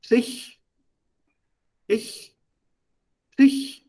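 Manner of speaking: sich as psich, repeated alone with pauses; first syllable drilled. Shown as a demonstration. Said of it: "and then comes the Isha".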